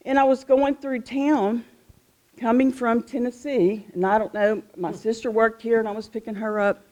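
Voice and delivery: ordinary delivery, nothing marked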